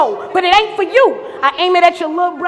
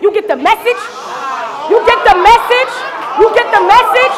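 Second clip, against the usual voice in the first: building shout; 3 semitones higher, 5 dB louder than usual. Acted. Taunting rival, repeating the question.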